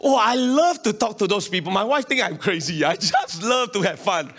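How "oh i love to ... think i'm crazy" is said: ordinary delivery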